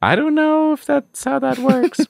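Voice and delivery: comically dubious falsetto; mock doubt. Real, not acted. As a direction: drag it out high, openly skeptical. Mocks the character's shaky reasoning.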